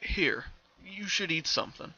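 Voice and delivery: put-on a slow, gentle father urging his child to eat; lightly voiced caring father; a father coaxing his daughter to eat. A narrator's reading. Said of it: "The ghostly image of her father".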